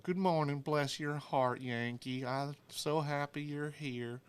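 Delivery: high, sugary Southern drawl, slowed on the blessing; fake-sweet Southern diner welcome, high and slow; Southern waitress accent. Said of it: "the waitress sounded like Justice".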